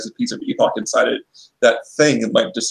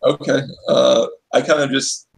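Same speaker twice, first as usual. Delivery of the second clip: usual speaking voice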